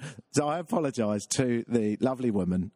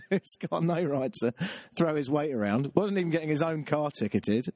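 ordinary talk

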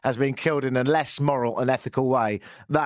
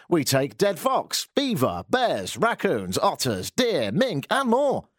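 slow, punchy announcer's list; radio advert; 5 semitones higher, slower than usual. Acted. Host lists animals in a mock fur ad, radio advert. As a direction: sell each animal like a radio commercial. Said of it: "advertise on News Talk ten ten".

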